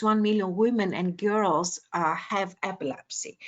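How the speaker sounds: ordinary delivery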